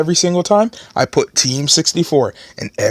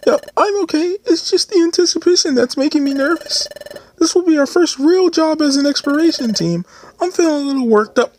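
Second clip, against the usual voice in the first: high nervous character voice; 13 semitones higher than usual. Acted. Voicing nervous partner character.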